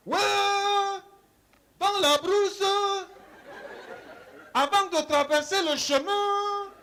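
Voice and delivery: loud, high village-elder voice; mock sage proverb. Mocking the wise elder reciting a proverb. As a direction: declaim it like a bush sage.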